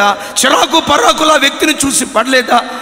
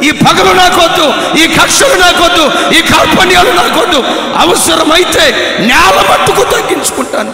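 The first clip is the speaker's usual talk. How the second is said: fiery preaching; 4 semitones higher, 5 dB louder than usual. Acted. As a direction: cry it out to the congregation. (బిగ్గరగా, ఉత్సాహంగా ప్రసంగం)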